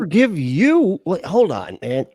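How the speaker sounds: high, loud, slow disbelief; incredulous. Real, not acted. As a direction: stretch out the shock of hearing that. Incredulous at the apology.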